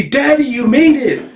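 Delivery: put-on high, loud little-girl squeal; excited little girl. Daughter greets dad arriving at her party, excited. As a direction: call out to Daddy, thrilled.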